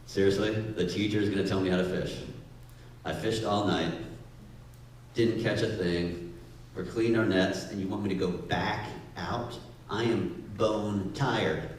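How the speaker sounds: low, soft, weary sarcasm; bone-tired fisherman grumbling under his breath; skeptical grumble. Retold or imitated. Peter grumbling to Jesus, low and weary. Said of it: "he's like".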